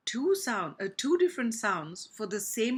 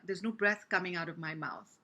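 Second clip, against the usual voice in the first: usual speaking voice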